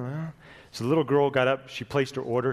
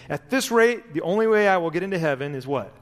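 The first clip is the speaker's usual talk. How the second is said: higher, louder pleading; desperation; 5 semitones higher, 5 dB louder than usual. Acted. Desperate man pleading with Gabriel at heaven's gate. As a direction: plead with the angel. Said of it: "cried the man in desperation".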